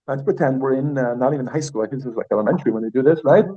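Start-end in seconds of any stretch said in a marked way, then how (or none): none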